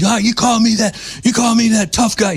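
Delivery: high mocking yell at a heckler; mock yelling host. Imitating the host yelling at a man who called him Fredo, in a high mock yell. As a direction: ham up his outburst, pitched high. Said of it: "started yelling and screaming at him".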